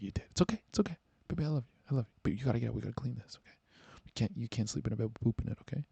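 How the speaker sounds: soft, tender reassurance; soothing boyfriend. Acted. Boyfriend reassures embarrassed girlfriend, softly soothing. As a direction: comfort them quietly, coaxing them up. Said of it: "You're like".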